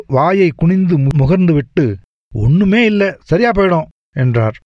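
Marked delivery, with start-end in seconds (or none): 2.3-3.9 higher, breezy, reassuring doctor voice with a fall on சரியா. Acted. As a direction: brush off the worry like a cheerful doctor. (நம்பிக்கையூட்டும் doctor)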